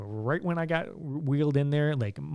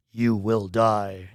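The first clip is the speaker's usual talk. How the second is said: slow, louder mock-dramatic pronouncement; dropped voice; 3 semitones lower, 5 dB louder, much slower than usual. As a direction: deliver the doom slowly in a dropped voice. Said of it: "he actually dropped his voice like that".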